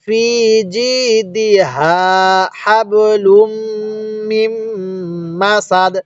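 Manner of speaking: stretched ফি and জি, short দি, hummed হাবলুম মিম; joined recitation. Shown as a demonstration. Teacher showing joined reading, long vowels and hummed হাবলুম. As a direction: link it slowly, ending crisply on সাদ. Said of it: "এবার আমরা মিলিয়ে পড়ব".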